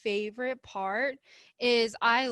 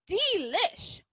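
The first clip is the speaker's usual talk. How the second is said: high squeaky mouse; delighted mouse voice; 8 semitones higher than usual. A narrator's reading. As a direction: savor the treat. Mouse says it in the picture.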